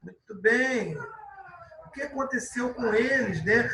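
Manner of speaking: ordinary talk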